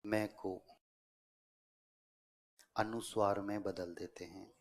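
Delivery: broken into short chunks with long pauses; dictation. Real, not acted. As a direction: dictate phrase by phrase, waiting for writers. Dictates a rule for writing. (श्रुतलेख, रुक-रुककर बोलते हुए)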